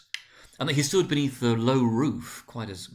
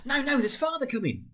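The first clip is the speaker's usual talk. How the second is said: put-on much higher childish cry; excited children. A narrator's reading. Young children warning their sister, high childish voice. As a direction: kids calling out in a rush. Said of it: "cried the two young Cratchits".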